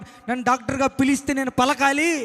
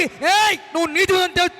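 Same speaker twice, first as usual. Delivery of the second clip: loud, higher call from God; divine declaration; 7 semitones higher, 4 dB louder, slower than usual. Acted. God calling the sinner righteous, in a loud declaration. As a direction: announce it with authority.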